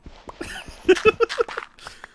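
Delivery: high airy giggle; breathy laugh. Real, not acted. Friend laughing after telling the duck nugget story. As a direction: laugh at herself.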